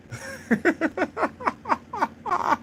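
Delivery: a real laugh that squeaks up high; high squealing laugh. Real, not acted. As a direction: crack up in a thin, high squeal. Cracks up at own question.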